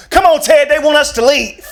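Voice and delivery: high, loud, nonchalant; arrested mom, unbothered; breezy mom. Retold or imitated. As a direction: casually call your husband. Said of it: "She look at my dad".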